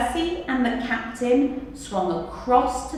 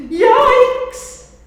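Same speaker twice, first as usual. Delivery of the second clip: yikes shriek; 13 dB louder than usual. Acted. Boy jumps into sea.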